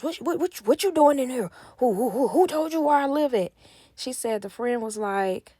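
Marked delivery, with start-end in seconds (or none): 0.0-3.5 a hint of a flustered man, higher and louder; mock panic. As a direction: stammer it like a caught cheater. Boyfriend caught, panicking, retold.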